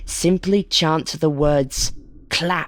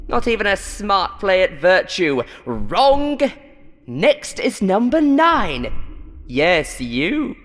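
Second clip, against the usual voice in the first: scornful showman; 5 semitones higher than usual. Acted. Host mocking number four's answer, scornful. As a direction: ridicule the answer.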